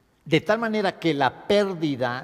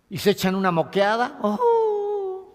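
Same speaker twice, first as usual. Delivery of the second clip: a sudden high hammed-up wail; mock sob; 6 semitones higher than usual. Shown as a demonstration. Teacher mocking someone crying in bursts, hammed-up wail. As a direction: fake a short burst of crying.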